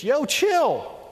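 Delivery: high, loud, laid-back "Yo, chill"; casual friend. Pretend. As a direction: toss it off like a buddy. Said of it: "good friends to say to us".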